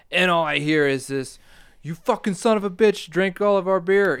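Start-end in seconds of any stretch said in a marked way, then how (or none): 1.8-4.2 put-on lightly done Southern country accent, shouted. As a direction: yell it in a rough country accent. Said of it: "trying to do the country accent now".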